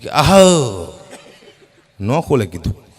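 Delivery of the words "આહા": a weak, hoarse hawk with the voice gone; worn-out throat-clearing. Retold or imitated. After three hours of hawking, uncle tries again, hoarse throat-clear. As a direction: try to clear the throat with no voice left. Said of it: "અવાજ બેસી ગયેલો".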